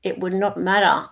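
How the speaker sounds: ordinary talk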